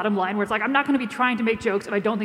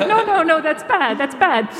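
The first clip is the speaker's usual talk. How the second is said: mock scolding; 4 semitones higher, 3 dB louder than usual. Scolding baby after reading news.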